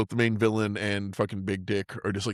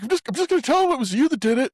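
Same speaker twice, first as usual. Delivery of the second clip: mock villain voice; 5 dB louder, faster than usual. Retelling the villain's threat.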